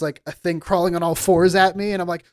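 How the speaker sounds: ordinary talk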